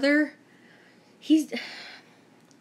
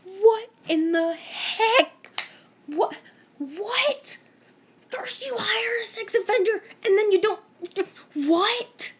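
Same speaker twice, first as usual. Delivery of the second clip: much higher, slow, half-crying disbelief; stunned outrage; 9 semitones higher, slower than usual. Retold or imitated. Learning the store hired a sex offender, high stunned sputter. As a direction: sputter in horrified confusion. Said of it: "And I was like".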